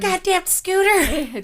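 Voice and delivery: slow, high, hammed-up grumble at the scooter; mock curse. Friend mock-cursing the scooter that fell on Shay, slow and hammy. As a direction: drag it out like a cartoon curse.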